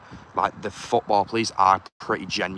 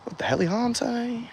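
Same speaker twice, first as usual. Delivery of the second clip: squeaky disbelief; 13 semitones higher than usual. Real, not acted. Repeats the phrase.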